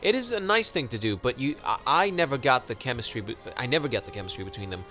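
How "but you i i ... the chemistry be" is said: usual speaking voice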